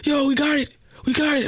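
high-pitched excited mock scream; acted-out ecstatic phone shouting. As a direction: imitate a dude yelling his good news. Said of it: "this dude screaming on the phone".

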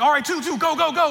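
high, urgent hustling call; rushing attendant. Retold or imitated. Ride attendant hurries riders aboard, urgent rushing. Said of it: "he's, like, rushing you to get onto the ride".

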